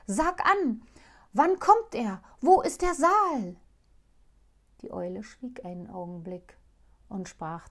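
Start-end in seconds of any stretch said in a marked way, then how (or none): 0.1-3.6 loud, high and urgent; loud, breathless eagerness; excited questions. Acted. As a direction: press her for answers. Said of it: "rief der Kalif".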